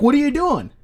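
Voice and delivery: high, loud, alarmed challenge; alarmed driver. Retold or imitated. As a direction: demand it like a startled driver. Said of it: "He's like".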